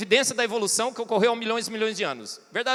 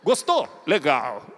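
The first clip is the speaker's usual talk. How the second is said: terse father; 7 semitones lower, 4 dB louder, slower than usual. Retold or imitated. Father's terse reply imitated.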